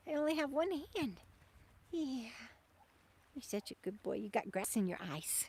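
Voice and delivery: soft, coaxing talk to a dog; soft pet talk. Real, not acted. Owner coaxing her dog Bandit up a jump, soft and tender. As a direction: gently praise and coax the pup.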